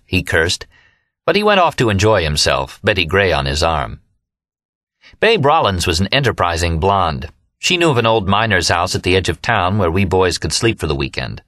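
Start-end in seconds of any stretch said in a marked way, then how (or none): none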